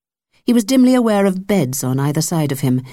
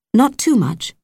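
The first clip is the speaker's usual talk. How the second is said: chiding; 8 semitones higher than usual. Acted. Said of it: "she chided".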